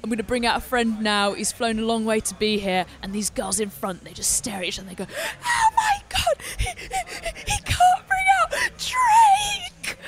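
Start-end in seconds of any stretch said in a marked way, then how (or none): 0.0-2.9 British accent imitating a rapper on stage. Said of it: "he's very British". 5.5-10.0 very high squealing imitation of hysterical fans; screaming fangirls. Acts out screaming fans at festival.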